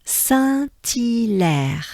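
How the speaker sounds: "Saint-Hilaire" very slowly as san-tee-lèr; merged French pronunciation. Shown as a demonstration. Teacher merging the parts for learners, slow san-tee-lèr. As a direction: stretch "Saint-Hilaire" syllable by syllable, t linked. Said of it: "it goes like this".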